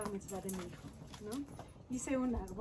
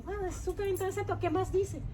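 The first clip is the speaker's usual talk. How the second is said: voz alta y animada, citándose a sí misma; excited retelling; 9 semitones higher, 4 dB louder than usual. Retold or imitated. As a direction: emocionada con el diario, repitiendo su reacción. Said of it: "y yo dije". (entusiasmo contado con voz más aguda)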